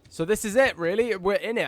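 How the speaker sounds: kicking off the new series with real excitement; excited. Real, not acted. Streamer opening a new campaign, excited. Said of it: "And I'm really excited".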